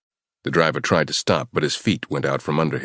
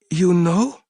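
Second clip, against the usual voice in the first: shocked and scared; 3 dB louder than usual. Acted. Terrified captive, shocked.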